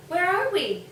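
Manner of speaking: wide-eyed wondering; curious child. Acted. Bao Bao asking Goonigan, high and curious. Child asks in strange place.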